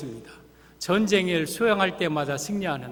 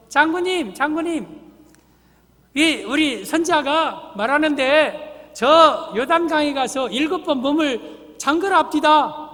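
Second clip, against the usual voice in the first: high-pitched servant relaying a message; servant calling out; 6 semitones higher, 3 dB louder than usual. Acted. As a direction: voice a messenger hailing his general. Servant calls out to the general.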